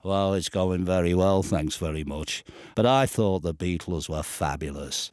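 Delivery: lightly voiced cheerful drummer. Impression of [name].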